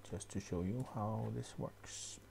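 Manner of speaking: unvoiced whispered aside to himself. Real, not acted. Tutor whispering an aside while editing the pattern. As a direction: whisper it half to yourself while clicking.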